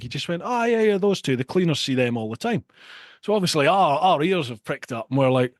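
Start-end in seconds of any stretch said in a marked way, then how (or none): 0.4-2.6 offhand, casual shrug of a line; calm as you like; blasé. Retold or imitated. Manager telling the bouncers it is nothing, blasé. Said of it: "all blasé, calm as you like".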